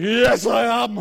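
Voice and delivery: put-on loud drawn-out drunk reply; far-off drunken answer; drunk man. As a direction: call back from the darkness, tipsy.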